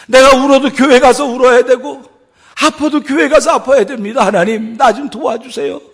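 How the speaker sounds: pleading prayer. Acted. Believer begging God for help to reach church, pleading loudly. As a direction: beg God for help with all you have.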